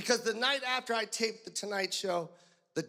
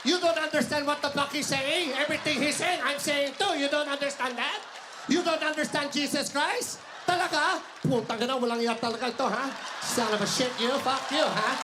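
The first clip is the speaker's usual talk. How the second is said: put-on higher, indignant Filipino-accented scolding; exasperated Filipino interpreter; 4 semitones higher than usual. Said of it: "And the interpreter's like".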